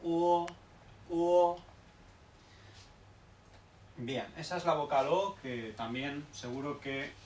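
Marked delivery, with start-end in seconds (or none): 0.0-1.6 O louder, from a small u into a full o; gliding o vowel. Shown as a demonstration. Teacher repeats the vowel O loudly to class. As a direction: swell the O open from rounded lips. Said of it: "Va desde la vocal un poco más pequeña".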